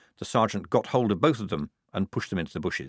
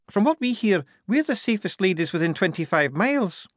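put-on very high working-girl voice; Prudence; 11 semitones higher than usual. Prudence reassuring Polly about the house, very high voice. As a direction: chatter away high and matter-of-fact.

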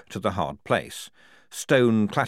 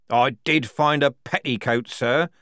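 slow, careful admission, raised voice; 7 semitones higher, 5 dB louder, slower than usual. A narrator's reading. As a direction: feel the way through the answer. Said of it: "feeling his way with care".

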